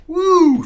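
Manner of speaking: very high, loud whoop; loud whoop of glee; gleeful whoop. Retold or imitated. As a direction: let out a big gleeful whoop. Friend falls into dumpster.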